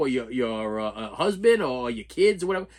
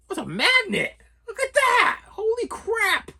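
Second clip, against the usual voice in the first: much higher and louder, squealing delight, drawn-out holy crap; excited surprise; 4 dB louder, slower than usual. Real, not acted. Unboxing a used DVD, real high excited squeal. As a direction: gasp at the giant magnet.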